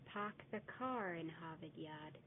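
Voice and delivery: "Park the car in Harvard Yard" slow, "car in" rolled together as "carin"; slow, careful Boston version linking "car" into "in"; correct Boston accent, linked R. Shown as a demonstration. Teacher giving the correct Boston pronunciation, slow and careful. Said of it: "The correct pronunciation would be".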